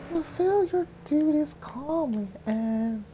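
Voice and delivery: put-on very high, breathy young heroine; high girl voice. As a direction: lift into a high girlish falsetto. Mulan's voice.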